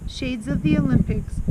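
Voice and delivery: plain reading voice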